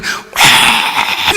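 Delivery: long groan of beaten fans; mock dismay. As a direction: imitate the side that let one in. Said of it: "골인을 들어간 편은". (골 먹은 편의 긴 탄식 흉내)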